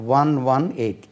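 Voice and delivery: ordinary talk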